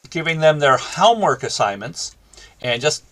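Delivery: ordinary delivery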